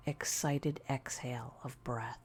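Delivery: ordinary delivery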